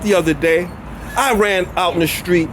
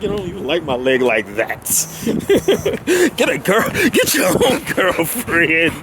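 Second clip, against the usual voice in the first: voicing the dog off. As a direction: shoo him off to find his own girlfriend. Scolding dog for humping leg.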